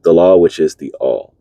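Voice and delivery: solemn recitation of a teaching; formal reading aloud. Acted. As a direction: read the old text like scripture. Reading a Hermetic text aloud.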